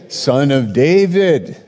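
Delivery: high, loud calling out; retold yell. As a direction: call out across the crowd. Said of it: "He yelled out".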